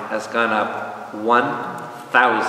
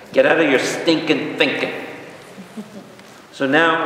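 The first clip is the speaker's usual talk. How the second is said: ordinary delivery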